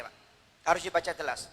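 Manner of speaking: ordinary talk, nothing marked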